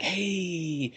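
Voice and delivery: high, slick hammed-up pitch; sleazy pitch to a mark; lightly voiced sleazy salesman; shyster salesman. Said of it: "shyster-looking guy".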